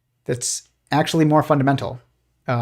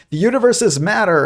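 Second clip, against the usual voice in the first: higher squeaky cartoon bickering; arguing cartoon physicist; 7 semitones higher, 3 dB louder than usual. Cartoon physicist arguing with another, higher insistent voice. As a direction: play a cartoon scientist insisting, pitched up. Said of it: "cartoon physicists arguing with each other".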